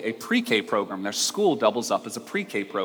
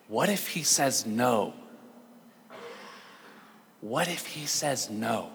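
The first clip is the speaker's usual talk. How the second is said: soft, slow, mildly tearful acting; retold tearful breakdown; 9 dB quieter, slower than usual. Boy breaks down before his teacher, quietly imitated tearfulness. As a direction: voice a hurt child giving in, quietly and slowly. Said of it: "he starts crying, just breaks down".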